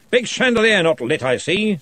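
jovial lordly wizard; booming Arch-Chancellor. A narrator's reading.

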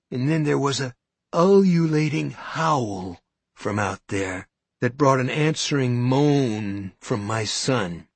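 ordinary delivery, nothing marked

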